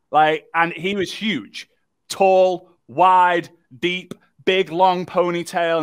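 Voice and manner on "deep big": ordinary talk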